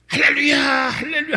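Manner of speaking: loud sung-out hallelujah, falling at the end; retold shout of hallelujah. Preacher shouting hallelujah at the subway rider. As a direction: call out hallelujah like a street preacher. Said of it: "하는 소리가 들렸대요".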